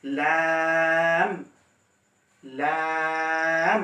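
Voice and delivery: লাম held long and slow; Arabic letter laam. Shown as a demonstration. Teacher reciting লাম slowly. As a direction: draw out লাম steadily. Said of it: "এরপর".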